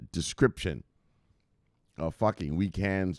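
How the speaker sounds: ordinary talk, nothing marked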